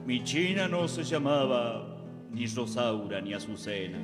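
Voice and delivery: ordinary talk, nothing marked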